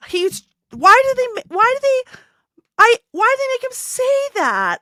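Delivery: high, pleading exasperation; exasperated disbelief. Real, not acted. Co-host baffled by the show's writing, exasperated disbelief. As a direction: protest in rising pitch, stumbling over the restarts.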